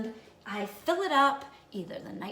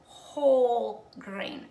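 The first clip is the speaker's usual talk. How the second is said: "whole grain" slow and louder, each word leaned on hard; stress on whole grain; 6 dB louder, much slower than usual. Shown as a demonstration. Host stressing the key food word to viewers, slow and loud. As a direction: drag out "whole grain" loudly to drive it home. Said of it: "I'm gonna stress that word again".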